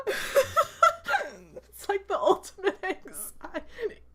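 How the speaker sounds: slow, high, laughing through the words. Real, not acted. Describing dead-silent announcing, slow and laughing. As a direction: stretch it out laughing.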